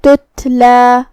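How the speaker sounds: تتلا with the second ta whispered, weak and breathy; soft tatla. Shown as a demonstration. Teacher shows students the soft sakin ta, gentle. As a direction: say تتلا letting the sakin ta come out soft and airy. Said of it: "تو ہم یہ کلمہ کو ایسے پڑھیں گے".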